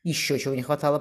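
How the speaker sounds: plain reading voice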